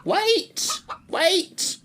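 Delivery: high, loud sharp command; shrill hen's commanding cry; abrupt commanding cry. A narrator's reading. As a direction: stop them with a sudden order. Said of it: "cried in an abrupt and commanding manner".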